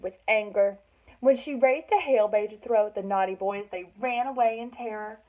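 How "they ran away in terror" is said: ordinary delivery, nothing marked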